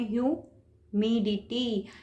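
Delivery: "Humidity" very slow, broken up, मि stretched; stress on मि. Shown as a demonstration. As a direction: separate the syllables of "humidity" and lean on मि. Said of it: "मी पे यहाँ पे stress दिया".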